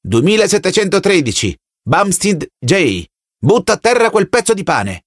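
distant authoritarian voice giving commands; commanding telescreen voice. Acted. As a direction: order him like a cold loudspeaker.

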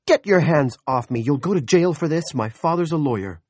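high, loud angry protest; indignant outburst. A narrator's reading. Woman to the man grabbing her, indignant and loud.

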